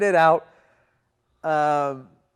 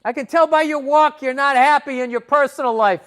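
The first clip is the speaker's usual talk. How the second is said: much louder, higher street holler; big booming yell; 12 semitones higher, 14 dB louder than usual. Retold or imitated. Man catcalling a passing woman, big yelled voice. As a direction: bellow it across the street. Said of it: "this big voice echoing off the fucking skyscrapers".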